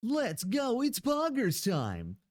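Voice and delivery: high, drawn-out "Poggers time!" call; hype call. Pretend. Streamer cheering as viewers throw stuff, high and hyped. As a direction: pump up the chat.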